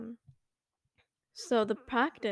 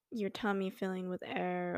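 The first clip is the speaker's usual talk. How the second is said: ordinary talk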